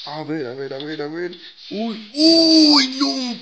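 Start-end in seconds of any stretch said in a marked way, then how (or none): 1.7-3.4 high, loud alarmed squeal; bad pull result, alarmed squeal; alarmed yelp. Real, not acted.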